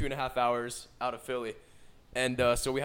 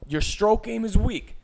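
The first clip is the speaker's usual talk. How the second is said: coaching voice; 7 semitones higher, 4 dB louder than usual. Pretend. Coaching a listener through sex.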